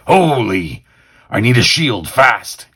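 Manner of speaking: high, loud urgent cry; urgent alarm. Acted. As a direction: shout it like a hero under fire. Hero under attack, acted.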